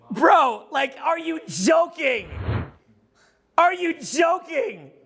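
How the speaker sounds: 1 stunned disbelief. Real, not acted. Marveling at the assassination photo.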